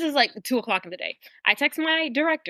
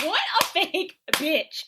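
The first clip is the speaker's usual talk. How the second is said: scornful disbelief; 6 semitones higher, slower than usual. Retold or imitated. Learning she lied about rehire.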